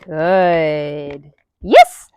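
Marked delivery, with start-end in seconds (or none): 1.6-2.1 a very high, loud yes; high excited marker. Real, not acted. Trainer marking the dog's back foot with a high squealed yes.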